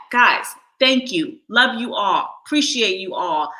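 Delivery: normal talking voice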